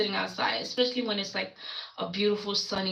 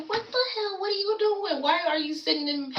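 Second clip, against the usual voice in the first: shocked; 9 semitones higher than usual. Retold or imitated. Shocked the girl is sitting there.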